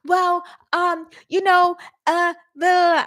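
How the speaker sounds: mock flustered hesitation; imitating stammer. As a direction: fumble for words like a caught liar. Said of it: "This lady started stuttering".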